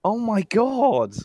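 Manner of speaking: high, drawn-out exclamation; stunned disbelief. Real, not acted. Co-host learns the stream never heard him, stunned disbelief.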